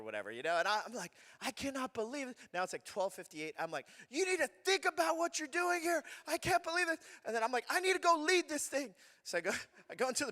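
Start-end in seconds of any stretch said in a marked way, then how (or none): 1.4-2.4 can't believe she did this; furious. Retold or imitated. 4.1-8.9 high, loud scolding; furious. Retold or imitated.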